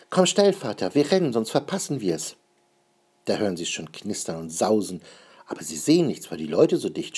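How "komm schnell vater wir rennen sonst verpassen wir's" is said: hoch und eilig; drängelnd aufgeregt. Acted. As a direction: ungeduldig den Vater antreiben. Kind drängt den Vater. (aufgeregtes Kind, deutlich höher)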